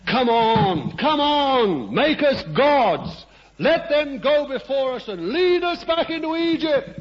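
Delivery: high, loud impatient crowd voice; mocking crowd demand. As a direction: whine it out like a restless mob demanding gods. People demanding gods from Aaron.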